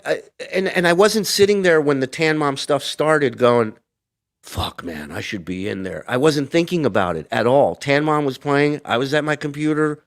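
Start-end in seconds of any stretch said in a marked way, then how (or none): 4.4-6.1 muttered regret. Retold or imitated. Retold inner grumble.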